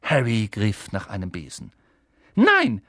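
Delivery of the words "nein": high, loud cry; calling out. Acted. Hermione calling Harry back, high and loud. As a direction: cry out to stop him. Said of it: "rief Hermine Granger".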